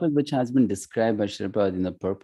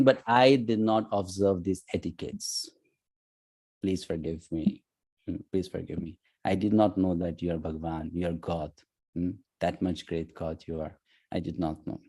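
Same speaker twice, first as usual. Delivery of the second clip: pleading apology; 4 semitones lower, 6 dB quieter than usual. Retold or imitated. Arjuna begging Krishna's forgiveness.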